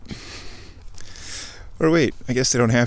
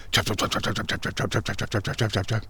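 soft repeated chop chop of rotors; helicopter noise; 7 dB quieter, faster than usual. Retold or imitated. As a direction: mouth the helicopter blades, quietly and steady. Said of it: "helicopter noises".

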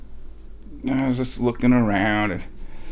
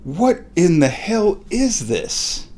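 slow, higher-pitched disbelief; astonished question; 6 semitones higher, slower than usual. Retold or imitated. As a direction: draw out each word in amazement. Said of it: "and I said".

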